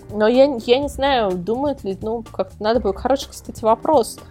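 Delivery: ordinary delivery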